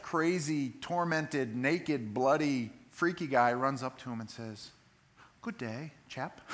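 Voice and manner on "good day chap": prim, genteel "Good day, chap" in a quiet British lilt; mock polite gentleman. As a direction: play it as an absurdly polite Englishman. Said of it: "you know how he talks".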